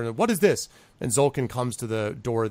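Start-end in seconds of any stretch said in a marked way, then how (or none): 0.2-0.7 higher, louder startled question; alarmed horsemen. Acted. Horsemen turn to each other at distant screams, alarmed. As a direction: blurt it out in alarm.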